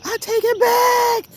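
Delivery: joyful squeal. Real, not acted. Apologizing to her guava tree, high joyful squeal. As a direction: take it back in a burst of joy. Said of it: "I'm sorry, y'all".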